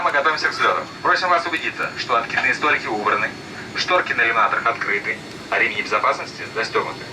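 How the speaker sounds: usual speaking voice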